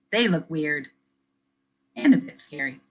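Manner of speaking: lively picture-book reading; reading to children. Acted. Teacher reading a picture book to kids, lively storytelling. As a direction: read it brightly for young listeners.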